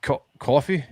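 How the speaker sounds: hesitant try at a British "coffee"; unsure mock British, questioning; attempted British accent. Shown as a demonstration. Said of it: "I can't do it".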